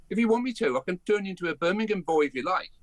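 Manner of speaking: Brummie accent. Shown as a demonstration. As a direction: slip into a Brummie lilt. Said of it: "look, I'm a Brummie".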